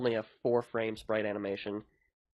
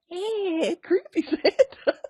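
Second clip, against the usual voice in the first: high, slow creepy creature voice; creepy Santa; 6 dB louder, much slower than usual. Acted. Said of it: "and then, like".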